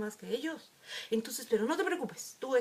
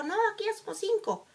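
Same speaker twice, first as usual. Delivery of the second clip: mock kid voice; 10 semitones higher, faster than usual. Other team refuses a child.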